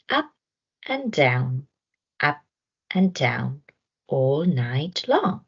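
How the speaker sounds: ordinary delivery, nothing marked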